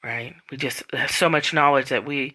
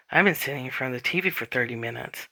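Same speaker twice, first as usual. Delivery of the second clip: fast, rushed dawning realization; sudden realization; 3 semitones lower, 5 dB quieter, faster than usual. Retold or imitated. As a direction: blurt it out as it dawns on you. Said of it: "that moment of".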